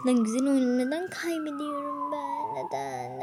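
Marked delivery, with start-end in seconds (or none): 2.5-3.2 low. Pretend. Repeats neden climbing in pitch.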